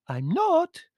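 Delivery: very high indignant countess; petulant high-pitched contradiction; squealed denial. A narrator's reading. Countess denying she is lying, shrill indignation. Quarrel, countess denies accusation.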